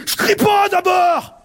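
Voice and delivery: high, slow shouted denial with a laugh breaking in; angry shouting. Retold or imitated. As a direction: bellow it as the woman who insists she isn't yelling. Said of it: "Pourquoi tu cries?".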